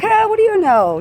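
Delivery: high, loud mocking scoff; dismissive scoff. Acting out a dismissive man.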